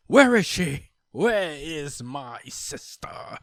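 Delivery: loud, high demand, then dragged slow; demanding shout. Acted. Brother storming in for his missing sister, loud slow demand. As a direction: burst in demanding your sister.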